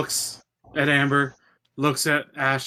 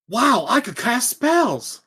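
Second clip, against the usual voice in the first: higher, slow, wide-eyed wonder; excited; 6 semitones higher, slower than usual. Acted. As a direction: gush like a thrilled kid. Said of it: "jump up excitedly".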